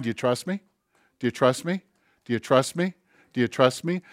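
soft, hushed repeated question; God asking softly. Acted. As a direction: ask it quietly, almost to yourself, like a gentle inner voice.

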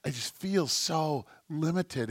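soft, troubled whisper to God; whispered prayer. Acted. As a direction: confide your worries in a hush. Acting out his troubled prayer.

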